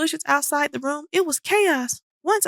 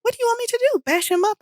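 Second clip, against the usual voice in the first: high, exasperated cry; frustrated scream; 8 semitones higher, faster than usual. A narrator's reading. Bobby screaming at Boris in frustration. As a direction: let the frustration burst out. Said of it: "I screamed, feeling frustrated and angry".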